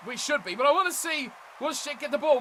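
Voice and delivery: ordinary talk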